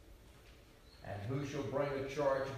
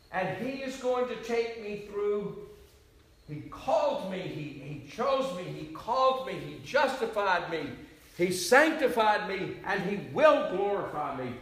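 rising, louder preaching build; fervent preaching; 7 semitones higher, 8 dB louder than usual. Real, not acted. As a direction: preach it with mounting fire.

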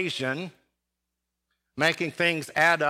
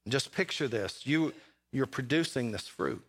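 quiet intimate; 4 semitones lower, 6 dB quieter than usual. Acted. Preacher drops voice to intimate.

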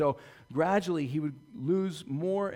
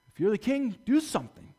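higher, louder scornful challenge; jeering dare; 8 semitones higher, 6 dB louder than usual. Acted. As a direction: goad him like a heckler. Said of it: "talking about his kingly ship".

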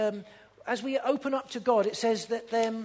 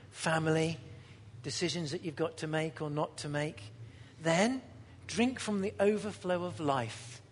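lower, softer invitation; soft pastoral appeal; 4 semitones lower, 4 dB quieter than usual. Acted. Pastor gently inviting the tired, soft tender appeal.

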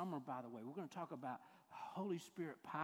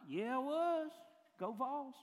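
high, loud hammed-up Tennessee accent; 6 semitones higher, 5 dB louder than usual. Supposed Londoner cheering his home team, hammed-up Tennessee accent. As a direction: insist in a big Tennessee accent. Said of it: "You sound like you're from somewhere like".

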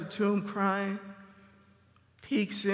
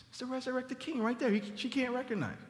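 ordinary talk, nothing marked

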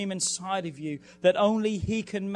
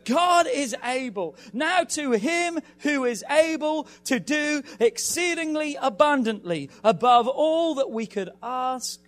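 preaching at full force, higher and louder; 4 semitones higher, 5 dB louder than usual. Acted. Pastor urging the congregation before the fast, full-force preaching. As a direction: drive it home like a revival preacher, rising.

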